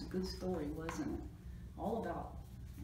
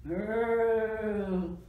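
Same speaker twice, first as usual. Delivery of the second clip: loud, drawn-out growl; spooky growl; 2 semitones higher, 8 dB louder than usual. A narrator's reading. Reader voicing the spooky noise behind a flap, growling. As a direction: growl like a storybook monster. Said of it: "they heard a spooky sound".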